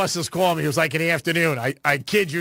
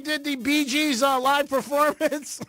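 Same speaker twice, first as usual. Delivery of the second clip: mock heckle yell; 8 semitones higher than usual. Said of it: "I'd be like".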